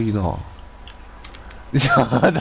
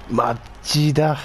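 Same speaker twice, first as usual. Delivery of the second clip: usual speaking voice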